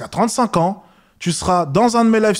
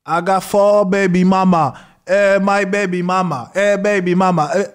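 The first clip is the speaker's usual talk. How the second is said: imitating a rapper; 3 semitones higher than usual. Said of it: "T'entends quoi souvent dans les musiques de rap?".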